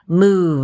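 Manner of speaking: move, the V held and buzzing; held final V. Shown as a demonstration. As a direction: hold the buzzing V at the end of move. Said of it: "If I hold out that final sound".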